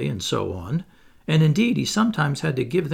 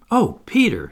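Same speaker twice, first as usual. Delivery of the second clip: higher, louder exclamation; eager cry; 9 semitones higher, 6 dB louder than usual. A narrator's reading. Wendy longing to see the fairy, eager cry. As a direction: cry out to him, voice lifted. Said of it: "she cried".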